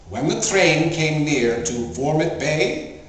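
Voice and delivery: usual speaking voice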